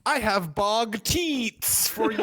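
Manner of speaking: "bog teats" read out slowly, as spelled; misspelled reading. Shown as a demonstration. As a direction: sound out "bog teats" literally. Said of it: "all of those words are misspelled".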